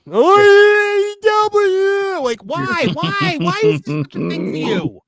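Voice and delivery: long drawn-out high cry, then high whiny questions; exaggerated baffled mockery of a fan; mock fan squeal. Mocking a fan's sudden excitement.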